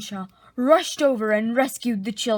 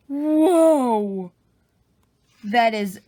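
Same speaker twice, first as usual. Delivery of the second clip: amazed; 5 dB louder, much slower than usual. Real, not acted. Reader reacts to the drawing.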